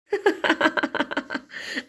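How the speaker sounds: soft giggling fit, trailing off; a string of quick ha-has, fading; laughter. Acted. Sister-in-law laughs at Chanchal in the oversized coat, giggling fit. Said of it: "यहाँ तो मुझे ही इतनी हंसी आ रही है".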